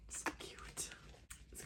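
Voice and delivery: soft whispered gush; adoring whisper. Real, not acted. Admiring a friend's book art, adoring whisper. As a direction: whisper it as if overcome by cuteness. Gushing over the book's art.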